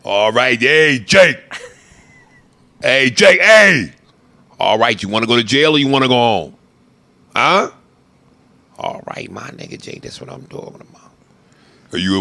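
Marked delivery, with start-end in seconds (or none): 0.0-7.7 loud, pushy hey Jake; hammed-up cop. Acting Training Day scene. 8.8-11.1 unvoiced hushed praise; whispered cop line.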